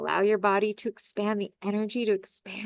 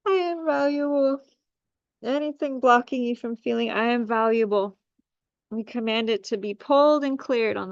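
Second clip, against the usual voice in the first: healing command; 2 semitones higher than usual. Acted.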